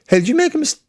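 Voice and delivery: high mock-snarky quip; snarky email. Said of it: "sent him a snarky email going".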